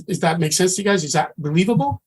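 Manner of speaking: normal talking voice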